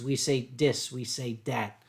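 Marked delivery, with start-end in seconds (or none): 0.4-0.9 DIS for "this", Philadelphia style. Shown as a demonstration. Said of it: "We don't, we don't say the". 1.4-1.8 DAT for "that", Philadelphia style. Shown as a demonstration. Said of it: "We don't, we don't say the".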